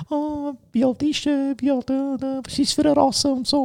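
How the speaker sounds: high, soft prying questions; nosy dog owner. Retold or imitated. Other dog owners pestering with questions, high and soft. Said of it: "wo dich dann immer so Scheiß fragen".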